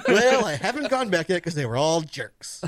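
high mocking voice through laughter; mock him while laughing.

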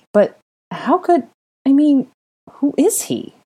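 high, halting, baffled questioning; unsettled. Acted. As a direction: stumble through it in bewilderment. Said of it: "unsettled by the child's curious appearance".

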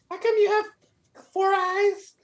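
put-on very high whiny child voice; little kid voice. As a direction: pester like a nosy kid. Kid voice continues, nosy questions.

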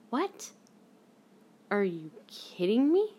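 high yelp, then slow rising question; re-enacted stunned disbelief, drawn out. Fan re-enacts hearing of Marty's retirement, disbelieving. Acts own reaction to retirement news.

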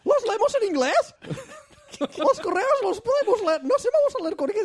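high goofy voice. Pretend.

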